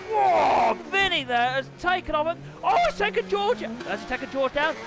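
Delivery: high, loud, thrilled race call; excited screaming commentary. Acted. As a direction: scream the crash like the crowd's on its feet. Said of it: "keep screaming like that".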